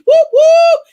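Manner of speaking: loud, high whoop whoop cheer; excited hype cheer for Mom in the house. Real, not acted.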